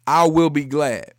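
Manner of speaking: loud, measured phrases for repeating; call-and-repeat declaration. Shown as a demonstration. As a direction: lead the congregation, pausing after each phrase. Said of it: "just say it after me".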